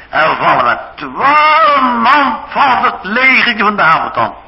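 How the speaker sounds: high and loud, listing the enemy's weakness with building force; rally the prince, each word hammered; fiery war counsel. Acted. Ahithophel urging Absalom to attack tonight, fiery and loud.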